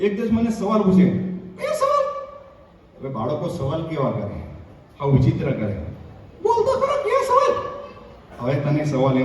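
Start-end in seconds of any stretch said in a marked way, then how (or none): 1.6-2.1 a mock listener piping up; high squeaky interjection. 6.4-7.5 a mock listener piping up; high squeaky interjection.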